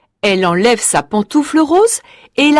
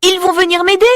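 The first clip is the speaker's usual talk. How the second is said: put-on hopeful girl; 8 semitones higher, faster than usual. A narrator's reading. Marie voiced in story.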